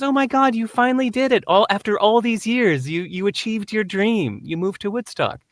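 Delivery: high, gushing amazement; excited congratulation. Retold or imitated. Friend celebrating his achieved dream, high-pitched excitement. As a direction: imitate a friend thrilled for him.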